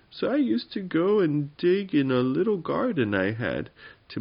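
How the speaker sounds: aggrieved little goblin. Acted. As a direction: pour out your woes as a small goblin. Little goblin tells its woes.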